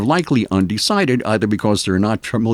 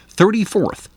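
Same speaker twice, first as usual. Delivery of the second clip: thirty-fourth said higher, in disbelief; incredulous repeat; 8 semitones higher than usual. Shown as a demonstration. Host repeating the men's ranking in disbelief. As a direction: repeat "Thirty-fourth" as if you can't believe it. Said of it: "that's hardly anything worth celebrating".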